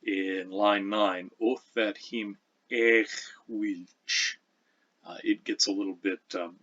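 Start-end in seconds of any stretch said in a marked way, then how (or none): 1.4-4.4 ech wilch with a throaty kh after the first vowel; slow Old English of that him ech wilch; velar fricative mid-word. Shown as a demonstration. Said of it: "there in the middle of a word".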